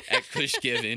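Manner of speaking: put-on light Jamaican accent; a hint of Jamaican accent. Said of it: "Is that your Jamaican accent?".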